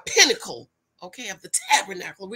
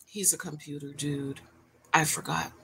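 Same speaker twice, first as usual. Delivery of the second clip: ordinary talk, nothing marked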